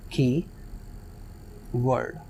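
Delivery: Keyword said very slowly and softly as की... word, almost whispered; split into syllables. Shown as a demonstration. Teacher showing students the syllable break, slow and soft. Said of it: "की एक अलग word है".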